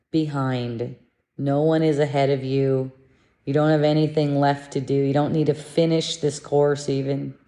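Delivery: ordinary talk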